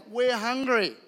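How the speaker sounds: lightly voiced hungry crowd; mock whine of the crowd; hungry crowd complaining. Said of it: "thousands of people are like".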